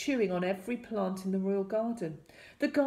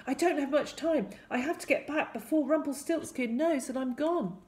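put-on higher-pitched young hero; urgent explanation; 7 semitones higher than usual. A narrator's reading. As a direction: explain it urgently to your parents. Hero hurriedly explains to parents.

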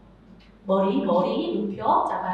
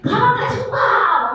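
high and louder cheerful call; 8 semitones higher, 8 dB louder, slower than usual. Shown as a demonstration. Teacher demoing a high, loud call to children. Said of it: "아이들 상대할 때는 솔".

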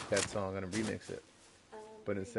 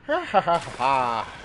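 leprechaun laugh; 4 dB louder than usual. Pretend. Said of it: "my leprechaun impression".

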